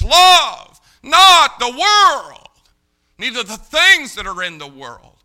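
shouted verse, high and drawn out at first; thundered scripture. Real, not acted. Preacher reads John's warning to folks, thundered scripture. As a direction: hit each opening word hard and long.

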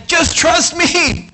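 high, slow and gentle reassurance; reassuring Jesus. Acted. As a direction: coax a scared person to trust you. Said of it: "the Jesus is saying".

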